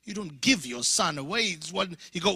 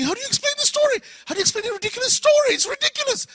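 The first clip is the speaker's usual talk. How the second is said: much higher, fast panicky squeal; panicked thought; 7 dB louder, much faster than usual. Retold or imitated. Panicked inner thought retold.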